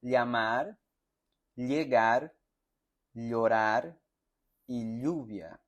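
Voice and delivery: "llamar" with a palatal ll, the tongue against the palate; teacher's ll drill; his own ll. Shown as a demonstration. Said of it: "voy a pronunciar como estoy acostumbrado".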